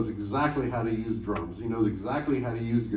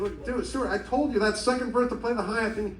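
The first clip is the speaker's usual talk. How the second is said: lightly voiced annoyed bandleader; 9 semitones higher than usual. Sting scolding the drummer, lightly mimicked exasperation.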